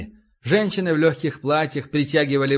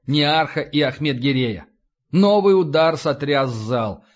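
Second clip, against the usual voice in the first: ordinary delivery, nothing marked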